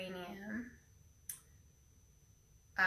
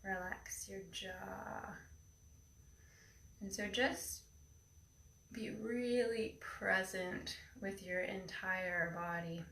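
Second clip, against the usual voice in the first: airy breathy mystical healer; 9 dB quieter than usual. Acted. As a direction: breathe each word into calm stillness. Leads a guided meditation.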